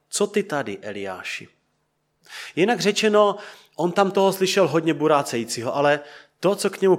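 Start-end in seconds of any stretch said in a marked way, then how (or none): 0.1-1.5 God's quiet voice, softer and low; soft gentle voice. A narrator's reading. God speaks softly to Elijah at the cave, hushed and gentle. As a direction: let it drop to a gentle hush. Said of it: "tichý a jemný hlas".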